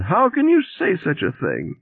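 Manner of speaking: very high, wailing reproach; keening reproach. A narrator's reading. Shopping bag lady reproaches Walter, keening. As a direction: wail it as the wounded bag lady. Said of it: "she keened".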